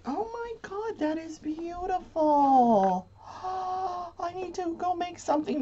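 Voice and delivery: breathless, high-pitched awe; awed delight. Real, not acted. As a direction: gasp at the beautiful yarn. Gasping at beautiful yarn.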